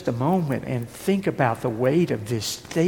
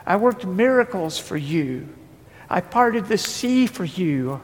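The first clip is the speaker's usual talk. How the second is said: full-force preaching; 7 semitones higher than usual. Acted. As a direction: preach hard and build the fire.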